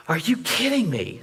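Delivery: "Are you kidding me?" pitched high, stress on kidding; incredulous, voice jumping up on "kidding"; voiced high exasperation at the professor; exasperated disbelief. Professor refuses to see video.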